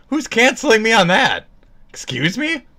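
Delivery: high, indignant outburst; loud, high-pitched mock offense; mock outrage. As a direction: cry out in put-on disbelief. Mock outrage at imagined cancellation.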